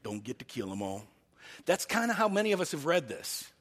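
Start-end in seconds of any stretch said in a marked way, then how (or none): 0.0-1.1 reluctant sheriff. Retold or imitated. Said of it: "God kind of reluctantly says".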